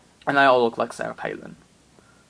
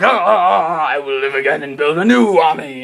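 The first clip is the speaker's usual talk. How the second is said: villain's parting vow; 7 dB louder than usual. Acted. Harold flees vowing return.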